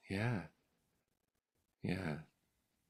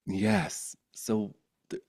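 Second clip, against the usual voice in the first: normal talking voice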